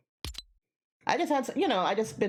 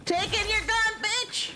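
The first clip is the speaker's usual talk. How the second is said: high, squealing gloat with a rising end; slower than usual. Real, not acted. Streamer looting a defeated player, squealing with glee. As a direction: crow it at the beaten enemy. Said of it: "Why are you screaming".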